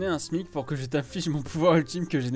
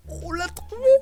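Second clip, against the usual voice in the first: high, thrilled exclamation; excited. Real, not acted. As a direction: announce it with rising joy. Excited discovery, calling out.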